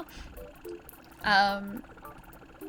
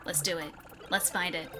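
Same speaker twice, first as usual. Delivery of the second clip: ordinary talk, nothing marked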